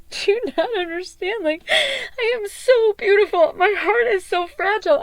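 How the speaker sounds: high, strained plea of hurt; high wounded appeal to partner; hurt pleading. Retold or imitated. Quoting herself to him.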